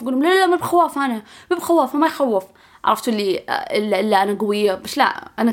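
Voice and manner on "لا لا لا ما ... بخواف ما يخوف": high, insistent mock denial; acted denial. Younger self denying to others she is scared, high insistent denial. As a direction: protest repeatedly with the pitch pushed up.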